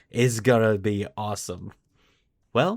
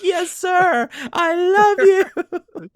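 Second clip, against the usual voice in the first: squealing fanboy soldier, much higher and louder; adoring soldiers; 12 dB louder than usual. Retold or imitated. Soldiers answering Yarrick, shrill adoring squeal. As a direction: gush like a starstruck trooper. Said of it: "And they're like".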